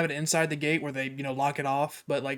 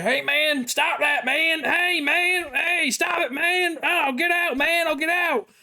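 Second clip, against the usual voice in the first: high-pitched, drawn-out mock shouting; imitated panicked yelling; 12 semitones higher, slower than usual. Homeless man yelling from the shaken porta potty, imitated high shouting. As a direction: yell like a man trapped inside, way up high.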